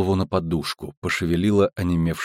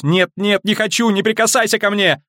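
very high panicked shriek; terrified scream; 12 semitones higher than usual. Acted. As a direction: scream in sheer terror. Ciri screams in terror. (панический крик)

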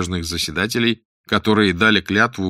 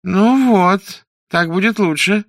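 high, raised voice of a pleased judge; livened-up judge; 10 semitones higher, 5 dB louder, slower than usual. A narrator's reading. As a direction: approve in a high, plaintive lilt. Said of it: "Верховный судья оживился". (оживившийся судья, высокий голос)

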